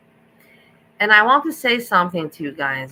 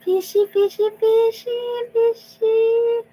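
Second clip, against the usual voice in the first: high cooing pet talk; cutesy fish call; 4 dB louder, slower than usual. Real, not acted. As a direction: coo at the fish in a tiny high voice. Calling a pet fish cutely.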